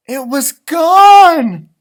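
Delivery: loud, high, slow wail; drawn-out shout of alarm; shocked cry. A narrator's reading. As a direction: cry out in dismay at the loss. Narrator discovers the gold is gone.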